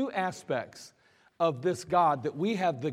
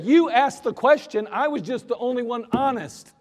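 higher, louder, sassy pushback; defiant reply; 7 semitones higher, 7 dB louder than usual. Retold or imitated. Soldier sassing the sergeant that he was only honest. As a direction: talk back with cheek.

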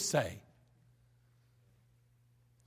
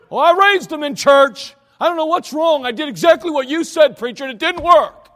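loud, high plaintive complaint; whining parent; 7 semitones higher, 12 dB louder than usual. Retold or imitated. A parent wailing to the preacher that nothing worked, loud and high.

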